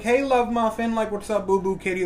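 sarcastic sweet talk pitched high; mock-sweet nicknames, dripping sarcasm; imitating wife's sarcastic pet names. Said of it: "it sounds a little sarcastic coming out of her mouth".

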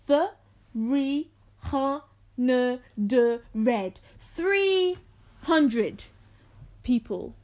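"Three" "hundred" "people" each spoken slowly, syllable by syllable; over enunciating. Shown as a demonstration. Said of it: "enunciate every single syllable".

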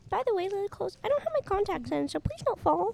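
high squeaky mock voice; imitating Ricky. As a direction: parrot a friend in a silly high voice. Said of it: "you're like".

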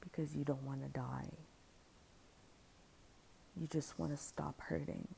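quiet and gentle; soft inner voice. Retold or imitated. Inner voice calming her, quiet and gentle. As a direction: speak it softly like comfort.

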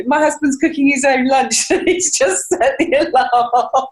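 high, laughing through the words. Real, not acted. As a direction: giggle as you explain. Laughs about husband and alarm.

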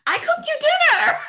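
very high, loud indignant shriek; outraged mock shout. To the date who ghosted her, hammed-up outraged shriek.

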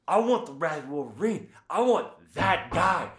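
high, loud wrestler's demand; fired-up promo imitation.

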